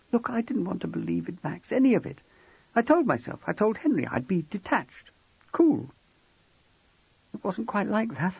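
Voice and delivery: high woman's voice, shaken and upset; a frightened wife pouring it out; tearful young wife. Acted. Narrator voices Jackie.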